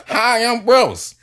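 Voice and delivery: Bruce Willis voice. Retold or imitated. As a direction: say it high and drawn-out like the star. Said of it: "In that voice".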